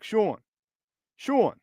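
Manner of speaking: playful New York accent on "Sean"; put-on New York vowel in "Sean". Shown as a demonstration. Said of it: "This is my New York accent".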